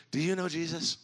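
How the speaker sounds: acting out a flight attendant witnessing to a passenger; leaning-in evangelist. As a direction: pose it as an earnest, put-on question to a stranger. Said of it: "she leans in".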